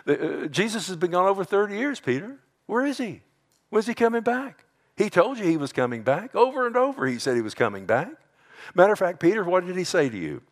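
high, needling challenge; mocking scoffer. Scoffer challenging Peter on the long wait, high mocking jeer. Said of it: "You know what mockers do?".

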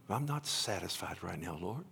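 low, hushed grumble to God; quiet protest. Retold or imitated. Alone reading, objects to the Lord, quiet protest. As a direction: retell a private objection under the breath.

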